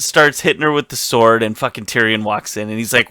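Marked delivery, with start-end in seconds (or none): none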